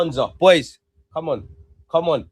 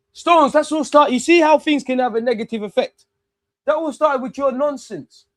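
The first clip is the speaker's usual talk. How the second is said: angry shout at the TV; 10 semitones higher, 5 dB louder than usual. Real, not acted. Fan scolding Stones through the screen, furious shout. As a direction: blast the player for his mistake.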